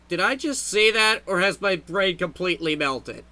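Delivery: ordinary talk, nothing marked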